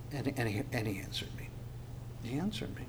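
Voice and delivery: soft, hushed wonder; preacher marvels quietly that God answered; awed whisper. Real, not acted. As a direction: let it fall to an amazed whisper. Awed repetition of the verse.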